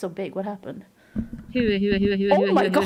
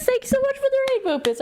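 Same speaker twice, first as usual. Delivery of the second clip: much higher, fast and bright thank-you; excited thanks; 4 dB louder, much faster than usual. Real, not acted. Streamer greeting raiders, excited and quick.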